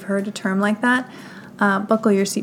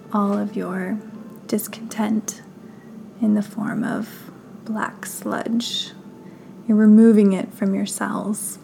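guided meditation; 5 dB quieter than usual. Acted. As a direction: guide them gently through breath and body, unhurried.

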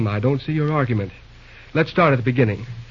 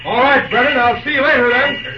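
high, raised-voice goodbye; calling out; 10 semitones higher, 4 dB louder than usual. Acted. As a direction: call back to someone as you part. Calls goodbye on entering office.

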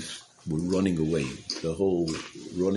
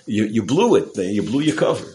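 loud, higher-pitched retold scolding; reproachful; 4 semitones higher, 9 dB louder than usual. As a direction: scold him in mock exasperation, voice raised. Others reproach him in story.

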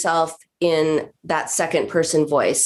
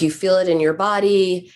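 normal talking voice

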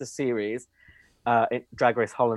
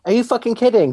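high, loud shocked outburst; mock outrage; 9 semitones higher, 7 dB louder than usual. Real, not acted. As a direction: play it scandalised and loud. Mock outrage at stolen rating.

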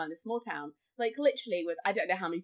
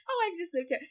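mock innocent; 8 semitones higher, faster than usual. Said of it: "not like".

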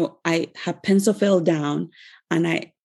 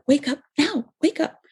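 urgent, higher-pitched call to wake up; mom waking her child; 8 semitones higher than usual. Retold or imitated. Mom rousing her child in the night, urgent higher call. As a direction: shake the sleeping child awake with your voice. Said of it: "She's like".

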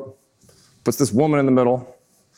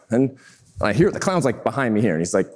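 ordinary talk

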